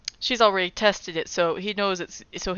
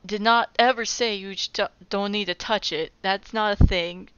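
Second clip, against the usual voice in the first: ordinary delivery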